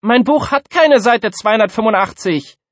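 high, loud pupil's complaint; whining classroom protest, pitched high; complaining. A narrator's reading. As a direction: pipe up with a loud grievance. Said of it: "beschwerte sich Ikea".

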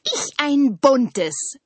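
high, long drawn-out call. A narrator's reading. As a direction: call it out slowly as the maple. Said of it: "rief der Ahorn". (hell und gedehnt rufend)

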